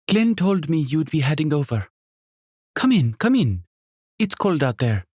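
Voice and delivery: high, lively hostess voice with a faint Jamaican lilt; a welcoming, bubbly landlady; energetic innkeeper. Acted. Narrator voices Sonia.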